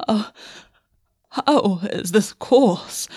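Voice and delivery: very slow, breathy, catching voice; catching breath. Acted. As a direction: let the breath catch on every word. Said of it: "sounds catching in the back of his throat".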